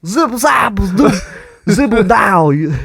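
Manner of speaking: very high scatting Cosby voice; bouncy Cosby nonsense words; Bill Cosby impression. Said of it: "my Bill Cosby impression".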